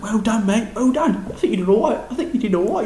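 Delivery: high, light, friendly reassurance; encouraging usher. Retold or imitated. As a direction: imitate a mate offering kind praise. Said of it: "the usher was like".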